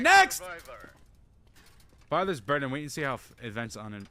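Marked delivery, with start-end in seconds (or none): 0.0-0.4 high, loud shout; sudden high yell; shouted call. Acted. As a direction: bellow for the next in line. Calling the next survivor.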